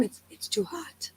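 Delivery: breathy whispered complaint; mock whispered grumble; whiny whisper. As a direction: whisper it like a sulking husband. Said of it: "this one's complaint the whole time".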